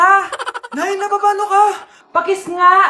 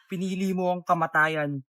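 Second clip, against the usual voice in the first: mock villain threat; 11 semitones lower, 8 dB quieter than usual.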